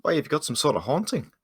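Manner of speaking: high, swooping question in character; intrigued adventurer's rising question; curious surprise. Acted. Adventurer asks nervous man about haunting.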